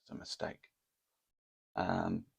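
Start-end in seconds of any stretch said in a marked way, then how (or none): 0.0-0.7 inner thought. Retold or imitated. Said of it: "I thought".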